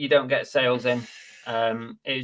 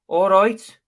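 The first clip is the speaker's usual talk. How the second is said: high, loud Brummie catchphrase; Brummie accent; 7 semitones higher, 6 dB louder, slower than usual. Shown as a demonstration. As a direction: put on a Birmingham accent, pitched up. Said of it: "to do a Brummie accent".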